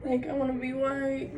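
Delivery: high whiny mock voice of her younger self. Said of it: "So I used to be like".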